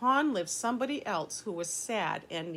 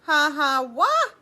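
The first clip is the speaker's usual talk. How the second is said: loud sobbing ha ha wah, rising much higher; mock sobbing wail; 7 semitones higher, 10 dB louder, slower than usual. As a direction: sob out loud like a child. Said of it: "said Little Beaver".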